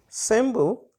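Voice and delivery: ordinary talk, nothing marked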